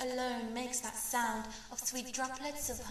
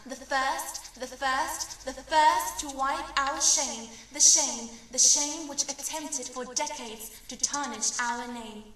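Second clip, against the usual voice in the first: rising declamation; 8 dB louder than usual. Acted. Poem building to emphatic climax.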